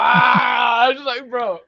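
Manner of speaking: shocked. Real, not acted. Shocked at heavy object.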